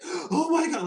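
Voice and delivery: high, loud "Oh my God" of disbelief; shocked gasp. Retold or imitated. People seeing an angel, shocked loud cry. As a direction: gasp it out like you just saw an angel. Others gasping, losing their minds.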